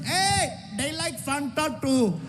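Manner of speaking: a light high mock yell from the crowd; lightly voiced Indian audience member; helpful crowd voice. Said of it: "They're yelling".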